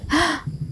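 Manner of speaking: short acted gasp; quick storybook intake of breath; startled gasp. A narrator's reading. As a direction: catch your breath. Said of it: "Elephant caught his breath".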